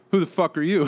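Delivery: loud aggressive shout; mock angry yell. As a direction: bark it like a rude driver. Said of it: "he was like".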